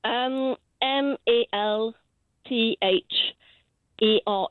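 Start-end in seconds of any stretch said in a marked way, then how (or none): none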